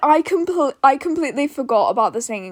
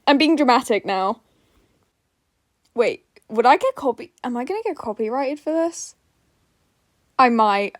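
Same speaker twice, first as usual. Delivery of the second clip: ordinary delivery, nothing marked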